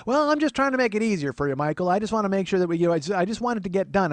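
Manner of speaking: high, loud fussing aunt imitation; defensive aunt. Aunt defending her help to nephew, fussy defensive voice. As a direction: protest as the well-meaning aunt.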